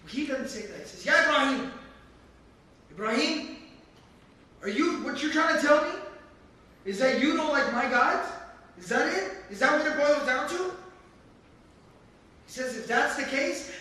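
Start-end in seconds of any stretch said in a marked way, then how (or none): 1.1-10.8 higher, louder accusing questions; indignant father. Acted. Father accusing Ibrahim of rejecting his gods, indignant. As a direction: corner him with each question.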